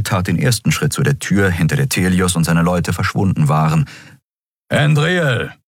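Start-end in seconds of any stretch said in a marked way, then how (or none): 4.7-5.5 higher-pitched call after someone. A narrator's reading. Keru stops Endriel heading for the wrong door, calling out. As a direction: call her back by name.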